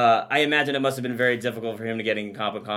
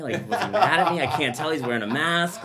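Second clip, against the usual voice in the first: high anxious words through laughter; laughing; 5 semitones higher than usual. Retold or imitated. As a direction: giggle through the worried questions. Anxious thoughts retold, laughing.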